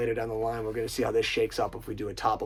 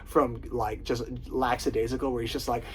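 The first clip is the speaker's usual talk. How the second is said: ordinary delivery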